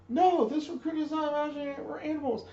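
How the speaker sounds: high, loud recruit reply; recruit shouting back. Retold or imitated. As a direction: sound off like a scared recruit. Recruit answering.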